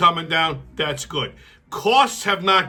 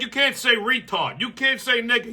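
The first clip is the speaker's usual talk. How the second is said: ordinary talk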